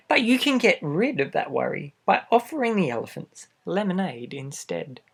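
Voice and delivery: lively storybook read-aloud; reading to children. A narrator's reading. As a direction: read it out to little listeners. Reads picture book aloud.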